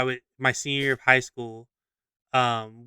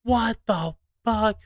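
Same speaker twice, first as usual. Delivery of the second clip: stunned disbelief; 6 semitones higher, 4 dB quieter, slower than usual. Retold or imitated. Acts bewildered reaction on ride.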